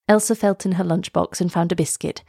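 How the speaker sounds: plain reading voice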